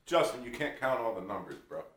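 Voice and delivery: usual speaking voice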